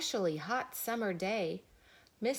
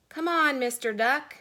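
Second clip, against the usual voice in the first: eager call; 6 semitones higher, 6 dB louder than usual. A narrator's reading. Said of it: "said Cow".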